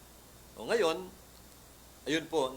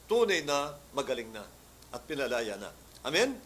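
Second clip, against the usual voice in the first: higher, louder preaching; fervent preaching; 3 semitones higher, 5 dB louder than usual. Acted. Pastor preaching to the congregation, fervent. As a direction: drive the testimony home to the congregation.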